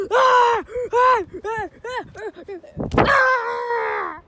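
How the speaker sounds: short panicked play screams; hammed-up repeated screaming; mock screams. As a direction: yelp as the storm hits. Attacked by the wind.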